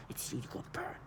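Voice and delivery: breathy unvoiced whisper of a threat. Woman whispering a threat to the speaker, hushed whisper. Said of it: "start whispering".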